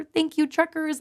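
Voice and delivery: put-on high child's voice thanking the truckers; little kid voice. Kids calling in on the trucker radio, high and sweet. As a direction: pipe up like a small kid on the radio. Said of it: "just little voices".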